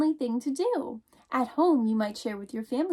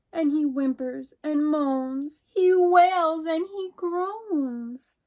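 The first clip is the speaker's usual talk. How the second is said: a little mouse calling out, startled; 4 semitones higher, slower than usual. Acted. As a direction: call out as if someone just knocked. Teacher reads picture book aloud.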